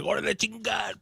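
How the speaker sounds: high-pitched Spanish outburst, imitated; hammed-up Spanish battle cry; mock Mexican wrestler. Attacker storming in on Stephanie Vaquer, mock high Spanish exclamation. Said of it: "She's like".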